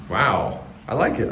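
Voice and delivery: ordinary delivery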